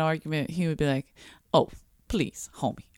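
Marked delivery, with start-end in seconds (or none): 1.5-2.8 mock whisper. Retold friend teasing his wife.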